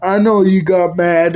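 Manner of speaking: ordinary talk, nothing marked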